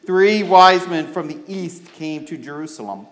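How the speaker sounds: put-on clear, lively storytelling for children; story reading. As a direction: read the Christmas story to the kids. Reads Bible story to children.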